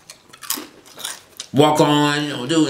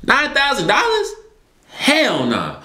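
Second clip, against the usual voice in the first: shocked disbelief; slower than usual. Retold or imitated. Shocked reaction to the bill.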